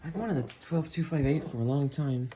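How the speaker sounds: ordinary talk, nothing marked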